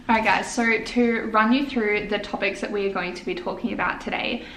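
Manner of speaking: ordinary delivery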